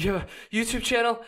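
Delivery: high, eager disbelieving question; breathless excited lady. Retold or imitated. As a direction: gasp it out, amazed. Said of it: "She's like".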